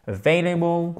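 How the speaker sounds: "Available" with stressed "vai" as a gliding vowel; stressed diphthong. Shown as a demonstration. Teacher models "Available" for students, stressed diphthong on "vai". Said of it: "Accent tonique ici. C'est une diphtongue".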